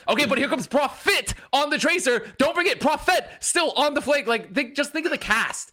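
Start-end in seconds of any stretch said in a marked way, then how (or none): 0.1-4.3 a hint of a hyped caster; mock play-by-play, raised; lightly done esports call. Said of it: "And everyone's like".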